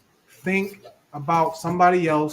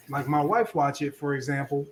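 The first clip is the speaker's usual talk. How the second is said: usual speaking voice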